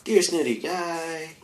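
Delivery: high, drawn-out mock greeting to a critic; mock polite address. Talking back to a snooty commenter, mock polite and slow. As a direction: sneer sweetly at the snooty guy.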